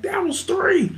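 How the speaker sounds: a playful mock referee; mock referee call.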